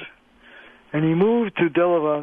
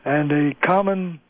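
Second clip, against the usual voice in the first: usual speaking voice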